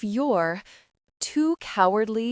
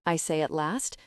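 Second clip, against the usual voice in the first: ordinary delivery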